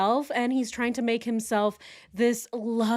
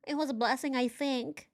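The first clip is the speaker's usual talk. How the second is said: softer, unsure imitation; hesitant mimicry; 5 semitones higher, 5 dB quieter than usual. Mocking the husband's unsure answer, soft hesitant tone. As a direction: say it doubtful, looking up. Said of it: "he says as he looks up".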